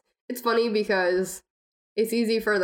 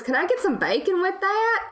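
put-on Southern accent; 12 semitones higher than usual. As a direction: order bacon like a Southern diner regular, mock style. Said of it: "you've become, like, Southern".

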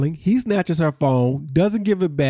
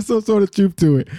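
put-on higher, laughing Haitian accent; mock Haitian accent; 5 semitones higher than usual. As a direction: playfully lay on a Haitian accent. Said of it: "I can get into my Haitian bag".

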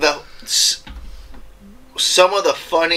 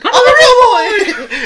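shrieking, very high, drawn-out mock Pinocchio cry; Pinocchio losing it; 8 dB louder, slower than usual. Retelling Pinocchio hit by the magic, ecstatic squealed impression. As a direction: squeal it out in hammed-up ecstatic disbelief. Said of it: "he's losing his mind".

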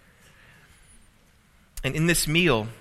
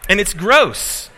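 loud, slow and higher, hamming up the disgust; mock disgust; 6 semitones higher, 9 dB louder, slower than usual. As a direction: drag out gross like it really grossed you out. Disgusted story punchline.

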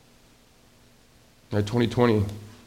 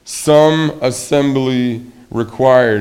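dreaded slow read; 6 dB louder, much slower than usual. Pretend. Dreaded words on the box.

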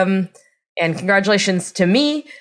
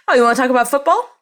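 higher, quick mock British accent; put-on British accent; 5 semitones higher, much faster than usual. Guest showing what she just says, mock British accent. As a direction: chirp it in a bad British accent. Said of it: "likes to put on this horrible accent".

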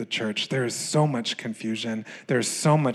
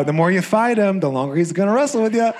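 friend's teasing advice; 8 semitones higher, 6 dB louder, faster than usual. Retold or imitated. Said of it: "My friend just leaves me there and goes".

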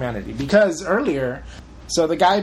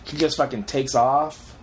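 usual speaking voice

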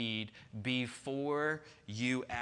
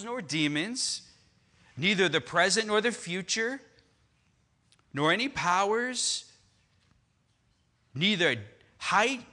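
higher, louder preaching that builds through the list; preacher reading Romans aloud to the church, climbing higher and louder; rising preaching; 4 semitones higher, 5 dB louder than usual. Acted. Building scripture read to climax.

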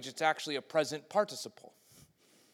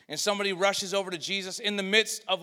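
ordinary delivery

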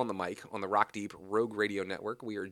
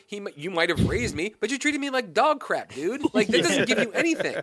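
higher and louder, worked-up outrage; real indignant rant; 10 semitones higher, 5 dB louder than usual. Real, not acted. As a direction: vent like the fan who got cheated. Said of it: "I was mad".

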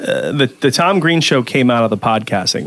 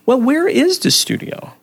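slow, much higher-pitched mimicry of a guest; imitated guest's question; 13 semitones higher than usual. Host mimics the visiting guest asking for the studio, imitated high-pitched question. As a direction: put on a high voice and draw the question out. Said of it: "And she goes".

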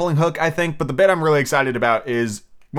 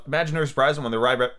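ordinary delivery

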